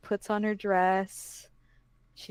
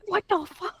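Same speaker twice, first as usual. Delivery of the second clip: startled, higher pitched swear; 9 semitones higher than usual. Acted. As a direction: blurt it out in shock. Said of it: "she's like".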